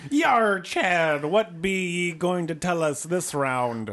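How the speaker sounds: loud, high, slow pirate growl; pirate skipper voice. Acted. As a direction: play a hearty old sea captain. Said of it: "that amazing skipper voice".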